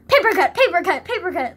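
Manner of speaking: high, loud, repeated battle cry; mock attack cry. Pretend librarian throwing paperbacks at kids, mock attack cry. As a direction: shout it like hurling each one. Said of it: "throw paperbacks at them".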